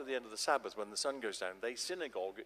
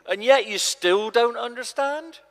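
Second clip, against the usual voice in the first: much higher and much louder, incredulous; exasperated cry; 11 semitones higher, 16 dB louder than usual. Acted. Jesus cries out at disciples who still don't understand. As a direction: throw the question out in disbelief.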